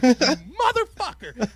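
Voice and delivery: loud, drawn-out, high-pitched curse; mad outburst. Retold or imitated. Livid debater at the girl who talked down, hammed-up anger. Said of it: "I was so mad".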